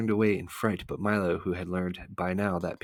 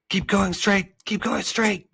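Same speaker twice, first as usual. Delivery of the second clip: very high, loud unseen guide; counseling voice; 14 semitones higher, 6 dB louder, slower than usual. A narrator's reading. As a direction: call out directions in a high little voice. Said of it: "counseled the voice".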